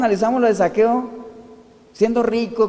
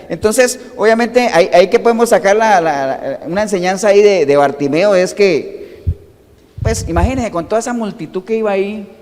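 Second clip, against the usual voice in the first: ordinary talk, nothing marked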